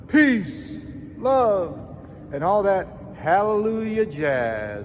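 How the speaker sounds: high, loud jokey farewell; hippie sign-off voice. Pretend. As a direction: ham up a hippie goodbye.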